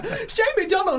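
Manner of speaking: excited fan. Retold or imitated. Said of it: "And people go".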